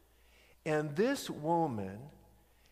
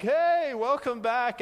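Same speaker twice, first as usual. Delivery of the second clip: bright, loud "hey, welcome back"; loud happy welcome to a returning student; cheery greeting; 9 semitones higher, 6 dB louder than usual. Retold or imitated. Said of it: "and I'm like".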